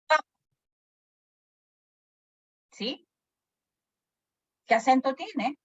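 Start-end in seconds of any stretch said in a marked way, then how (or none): 0.1-0.2 "Papá" said PA-pa, very short; stress on first syllable. Shown as a demonstration. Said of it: "acento grave". ("Papá" con acento grave, en la primera sílaba)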